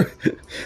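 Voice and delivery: a short unvoiced burp. Real, not acted. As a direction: let out a quiet burp mid-sentence. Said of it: "sorry, that's my burp".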